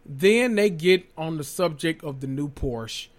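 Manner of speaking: ordinary talk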